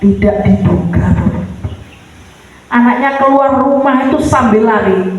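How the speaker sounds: ordinary talk, nothing marked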